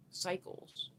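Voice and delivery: whispered echo of the word. Real, not acted. Musing to viewers, whispering Cycles.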